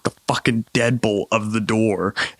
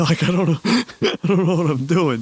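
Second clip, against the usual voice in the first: words breaking into real laughter, pitched up; laughing at his own sleepy excuse; 7 semitones higher than usual. Real, not acted. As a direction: giggle through it, can't hold it together. Cracking up retelling morning.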